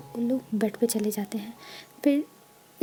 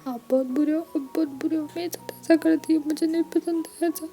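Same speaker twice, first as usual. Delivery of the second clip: high, pained, sulky whine; whiny complaint; 4 semitones higher than usual. A narrator's reading. Wing whines at Lanjan about his aching back. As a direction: whimper it like a hurt, sulking lover.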